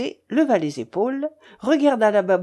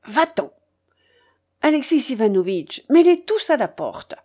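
rising indignant order; exasperated command, higher at the end; angry dismissal; 4 semitones higher, 4 dB louder than usual. A narrator's reading. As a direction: throw them out imperiously. Furious old woman throws them out.

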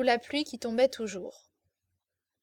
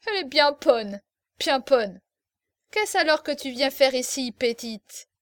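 louder, mocking Parisian accent; 6 dB louder than usual. Officer mocking the girl in a Parisian accent. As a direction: put on a Paris accent to mock her. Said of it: "cherchant l'accent parisien".

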